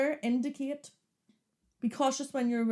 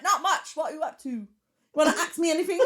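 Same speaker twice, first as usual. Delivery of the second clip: higher, put-on English accent; 7 semitones higher, 5 dB louder than usual. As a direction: dictate in a mock English accent, close to laughing. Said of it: "if I do an English accent".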